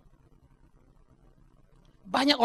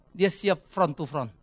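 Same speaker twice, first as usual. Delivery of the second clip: ordinary talk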